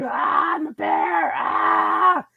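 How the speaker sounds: high-pitched mock bear roar; man acting bear. Man in bear suit walking the city, roaring like a bear. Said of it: "He'd be like".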